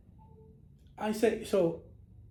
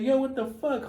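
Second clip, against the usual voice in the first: higher-pitched protest, not shouted; mock indignation; 7 semitones higher than usual. Woman to the man who grabbed her hand, mock indignant. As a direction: act out an offended comeback.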